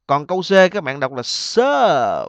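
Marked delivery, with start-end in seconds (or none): 1.2-2.3 "surf" loud with a long stretched er vowel. Shown as a demonstration. Teacher contrasting option C for students, long ơ vowel. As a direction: draw out "surf" and its final f. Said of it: "Âm ơ dài".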